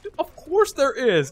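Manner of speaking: high, loud, drawn-out mock exasperation; retold exasperation. Player answering his teammate's obvious discovery, mock exasperation. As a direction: stretch it out in playful disbelief. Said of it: "I was like".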